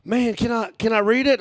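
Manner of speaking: high-pitched eager tourist request. Retold or imitated. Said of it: "He's like".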